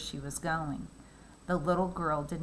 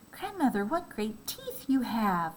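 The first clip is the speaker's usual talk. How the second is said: put-on frightened girl; 6 semitones higher than usual. Girl alarmed.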